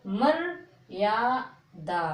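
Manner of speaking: मर्यादा spoken slowly, the half र said before या; मर्यादा modelled slowly for pupils; slow teaching demo. Shown as a demonstration. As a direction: sound out मर्यादा slowly, मर then यादा. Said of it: "रा की मात्रा बोलेंगे".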